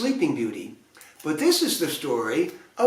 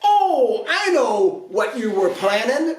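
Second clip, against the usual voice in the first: much higher, louder eager realisation; eager prince; 7 semitones higher, 4 dB louder than usual. A narrator's reading. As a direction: prince to the fairies, high loud eager idea. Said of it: "Prince Charming spied".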